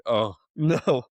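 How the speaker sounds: dismayed oh no broken by a laugh; retold dismay, laughing. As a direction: groan it out through a laugh. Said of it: "I was like".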